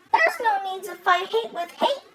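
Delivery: normal talking voice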